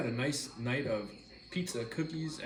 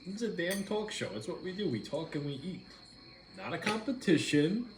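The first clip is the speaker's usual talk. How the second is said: exasperated; 6 semitones higher than usual. Real, not acted. Exasperated telling partner to stop.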